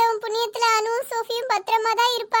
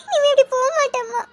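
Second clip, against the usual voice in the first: high, crying sorry; tearful apology; 5 semitones higher than usual. Acted. Scolded daughter apologises to her mother, crying. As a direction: sob out the promise.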